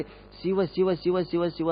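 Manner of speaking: very soft, repeating Shiva under the breath; frightened muttered prayer. Acted. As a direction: murmur the name to calm your own fear. Scared teacher chanting god's name.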